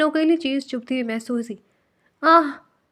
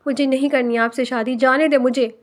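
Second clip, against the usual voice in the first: ordinary delivery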